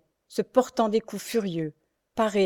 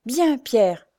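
higher exclamation of gratitude; exclaimed approval; 4 semitones higher, 4 dB louder than usual. Acted. Louise thanking her only defender, exclaiming. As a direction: cry out in grateful approval. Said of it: "s'écria-t-elle".

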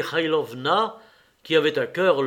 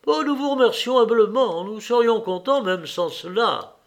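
higher, louder humble thanks; grateful peasant; 8 semitones higher, 4 dB louder than usual. A narrator's reading. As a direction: thank the master in a lifted voice. Peasants thank their master.

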